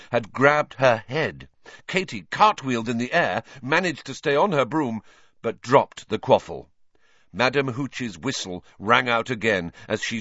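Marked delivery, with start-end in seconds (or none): none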